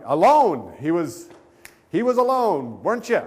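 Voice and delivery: loud, rising to high-pitched protest; mock disbelief, pushed hard; loud incredulity. Man challenges guest's claim of being alone, incredulous.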